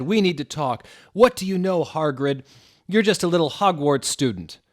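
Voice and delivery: high, loud mocking challenge; indignant challenge. A character taunting Hagrid as a mere student. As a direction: sneer at him. Quarrel voiced.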